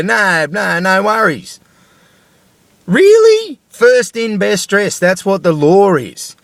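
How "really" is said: high, louder scornful "Really?"; sarcastic disbelief. Real, not acted. Ranting at the system, a scornful high "Really?". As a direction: throw it back with mock disbelief.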